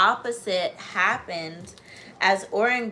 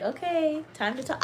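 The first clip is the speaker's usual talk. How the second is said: dog's voice; 8 semitones higher than usual. Pretend. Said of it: "She's like".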